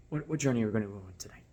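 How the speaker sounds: quick, hushed whisper; whispered invitation. Shown as a demonstration. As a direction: whisper it like an intriguing offer. Sample intimate question to guest.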